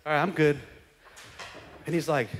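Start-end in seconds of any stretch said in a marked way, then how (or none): 0.1-0.6 mock careless.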